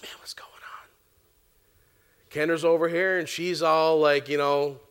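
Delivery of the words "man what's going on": hushed whisper to himself. Retold or imitated. Whispering to himself during worship. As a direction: breathe it out under the music.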